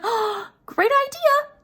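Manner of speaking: hammed-up wife thrilled by the idea; mock delighted. Wife answering her husband, high and sing-song. Wife voiced in retold story.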